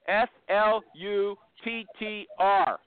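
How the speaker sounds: slow, deliberate letter by letter; measured spelling for the listeners. Real, not acted. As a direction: spell each letter slowly and separately. Host spells out company name.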